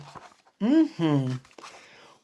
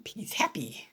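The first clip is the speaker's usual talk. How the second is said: plain reading voice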